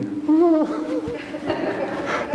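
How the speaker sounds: high breaking teary laugh; a laugh that cracks into tears. Real, not acted. Choked up with joy on stage.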